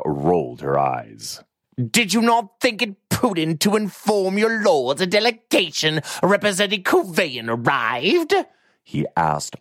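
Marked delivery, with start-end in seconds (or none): 1.8-8.3 high, nasal-free pleading whine of a pompous lord; almost whining. A narrator's reading. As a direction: whine it like a spoiled rich man. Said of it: "almost whining".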